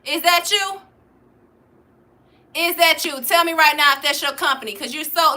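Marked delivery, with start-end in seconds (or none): none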